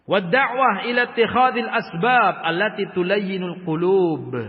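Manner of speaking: recited Arabic from the book; Arabic text recitation. Acted. Reads Arabic kitab text.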